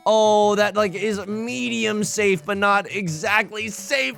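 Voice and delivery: slow, high-pitched wail of real dismay; anguished wail. Real, not acted. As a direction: moan it as the tile goes wrong. Dangerous tile drawn, anguish.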